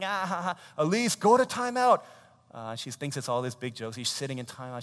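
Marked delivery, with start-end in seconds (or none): 0.0-0.6 high-pitched mock laughter; put-on "ah-ha-ha" laugh; imitated child laugh. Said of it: "she's, like, laughing". 0.8-2.0 high, loud mock scolding; acted parental command; exasperated parent. Acting parent sending child away.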